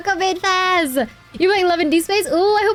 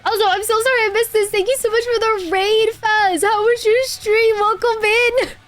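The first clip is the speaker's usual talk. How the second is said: high bubbly streamer greeting; chirpy welcome to raiders; cute anime streamer; 7 semitones higher than usual. Acted.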